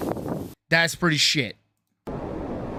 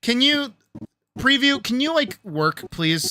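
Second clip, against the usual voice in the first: high pleading; 10 semitones higher, 4 dB louder than usual. Real, not acted. Pleading with software.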